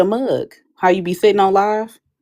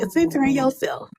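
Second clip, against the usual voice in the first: whispered jab; 7 semitones higher, 5 dB quieter than usual. Retold or imitated. End of quoted rant.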